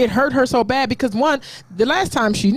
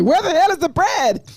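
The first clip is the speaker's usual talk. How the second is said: higher-pitched, amused mock demand; quoted heckle; 8 semitones higher than usual. Host quotes Karan asking about bread, high mock demand. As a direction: toss out a joking demand after a laugh. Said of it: "Karan said".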